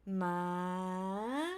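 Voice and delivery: "ma" held low, then gliding up; rising tone. Shown as a demonstration. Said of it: "it starts from low pitch".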